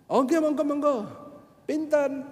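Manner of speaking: high, loud eager Javanese becak driver. Retold or imitated. Pedicab driver greeting a late-night customer, high and eager. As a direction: play the keen pedicab man, pitched high.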